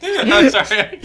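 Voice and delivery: high, loud apology lost in laughter; real laughter swallowing the apology; laughing apology. Real, not acted. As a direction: say sorry while you can't stop laughing. Still cracking up.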